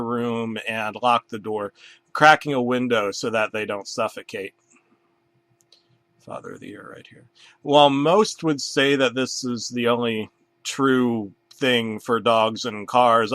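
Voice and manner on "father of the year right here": unvoiced deadpan whisper; whispered aside. Real, not acted. Dad whispering a smug aside to audience. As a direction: mutter it under your breath.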